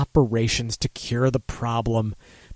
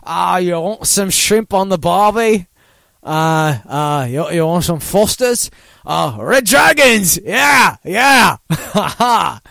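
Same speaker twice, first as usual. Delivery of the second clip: put-on Australian accent; 3 semitones higher, 3 dB louder than usual. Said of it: "He feels like he's an Outback Steakhouse".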